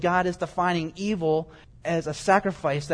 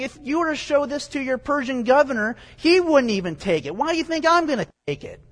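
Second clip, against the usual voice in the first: higher, incredulous indignant retort; God; 8 semitones higher, 4 dB louder than usual. Acted. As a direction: put the offended challenge up high, ending in a higher question. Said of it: "God's saying".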